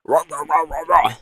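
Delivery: rapid imitated dog barks; dog barking. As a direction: bark brightly like an anchor dog. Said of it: "like, dog news reporters".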